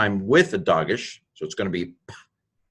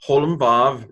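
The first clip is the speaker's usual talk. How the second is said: ordinary talk, nothing marked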